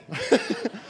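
short high laugh; high giggle. Real, not acted. Preacher laughing at his own cupid joke, a high giggle.

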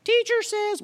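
put-on high, light voice as the little girl in the movie; childlike quote voice. Preacher quoting a child from the movie, high and light. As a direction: pitch it up like a child reciting. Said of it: "what's the famous line?".

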